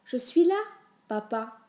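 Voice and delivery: small, high-pitched timid voice; tiny little voice. A narrator's reading. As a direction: answer Dad in a little high voice. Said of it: "d'une toute petite voix". (toute petite voix aiguë)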